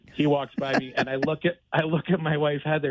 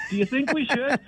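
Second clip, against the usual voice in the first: pitched way up, thrilled fan; eager retold question; 12 semitones higher than usual. Fan answering the star's photo offer, high and excited. Retelling his eager reply to star.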